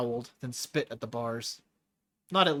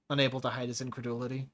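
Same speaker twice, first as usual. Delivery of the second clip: plain reading voice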